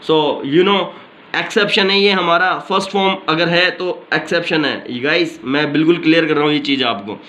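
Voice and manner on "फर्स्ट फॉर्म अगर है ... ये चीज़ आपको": ordinary talk, nothing marked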